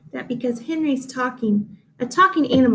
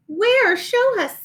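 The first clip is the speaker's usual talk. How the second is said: much higher, louder, pushy little sheep voice; eager sheep; 10 semitones higher, 3 dB louder, slower than usual. A narrator's reading. The sheep demand Henry show them, eagerly high. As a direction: squeak it like impatient sheep. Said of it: "said the sheep".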